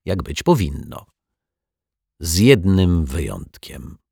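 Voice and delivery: plain reading voice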